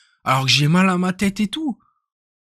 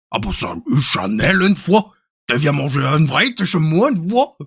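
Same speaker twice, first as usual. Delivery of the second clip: hammed-up Belgian, rising at the tags; Belgian accent. Shown as a demonstration. Belgian inviting Chanel over for fries, mock Belgian accent. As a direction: put on a singsong Belgian accent. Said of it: "je fais l'accent belge".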